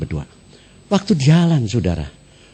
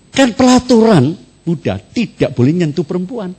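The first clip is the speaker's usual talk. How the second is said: ordinary talk, nothing marked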